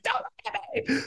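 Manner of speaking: tiny, very high soft squeak; hammed-up shy little shriek; mock-embarrassed squeal. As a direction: squeak it as if hiding your body. Acts covering self while fleeing.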